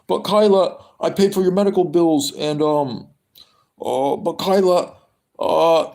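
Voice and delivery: high, pleading, whiny lover. Retold or imitated. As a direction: beg her not to leave, high and desperate. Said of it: "[name]'s impression begging Kyla to stay".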